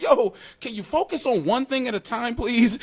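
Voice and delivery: high, pleading to focus; pleading kid. Retold or imitated. Kid begging parents mid-whipping, high pleading whine. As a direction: whine for one thing at a time. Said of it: "And I'm like".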